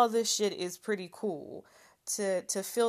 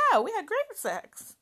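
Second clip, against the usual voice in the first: high, louder mock-bright claim; fake cheerful; 10 semitones higher, 4 dB louder than usual. Telling the man the sex was great, mock cheerful. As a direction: play it breezy and pleased. Mimicking her past cheery self.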